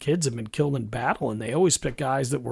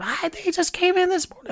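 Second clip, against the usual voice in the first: very high, jumbled imitation of a frantic mom. Son mimicking his mother jumbling words in panic, very high. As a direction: run the words together, pitched way up like a mom in a panic. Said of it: "every word would turn into one, one long word".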